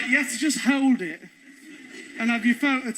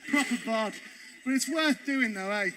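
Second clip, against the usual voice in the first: usual speaking voice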